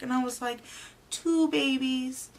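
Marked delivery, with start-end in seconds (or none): 1.1-2.3 slow, high, loud disbelief; awestruck amazement. Retold or imitated. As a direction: stretch it out in retold amazement. Retold shock at having twins.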